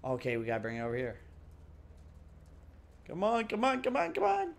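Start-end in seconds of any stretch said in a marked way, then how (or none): 3.1-4.5 rising high, pleading come on; impatient coaxing; impatient urging. Real, not acted. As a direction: beg it to hurry, climbing higher. Urging elevator to move.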